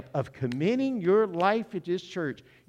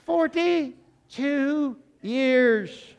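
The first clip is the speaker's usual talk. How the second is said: drawn way out, high and loud in disbelief; slow, amazed forty-two years; incredulous drawl; 9 semitones higher, 4 dB louder, much slower than usual. Acted. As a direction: stretch it out like you can't believe it. Punchline repeated for the congregation.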